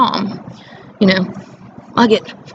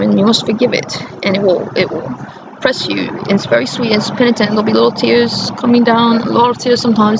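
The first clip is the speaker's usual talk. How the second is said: ordinary delivery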